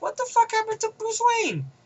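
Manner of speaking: high-pitched squeal of mock shock; mock disbelief. Viewer seeing Bruce Wayne with a cane, high mock disbelief. Voices a viewer's stunned reaction.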